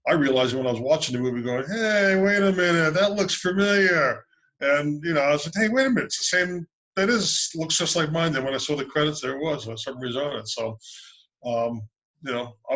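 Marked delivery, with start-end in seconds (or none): 1.7-4.2 surprised. Retold or imitated. Recalling recognizing his own submarine. 5.6-8.3 surprised. Retold or imitated. Recalling recognizing his own submarine.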